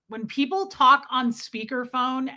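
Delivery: normal talking voice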